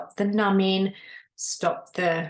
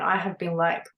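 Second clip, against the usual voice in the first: ordinary talk, nothing marked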